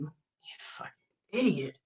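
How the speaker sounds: hissed whisper aside; whispered insult. Real, not acted. Streamer whispering an insult at her rival, hissed aside. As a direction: whisper the insult under your breath.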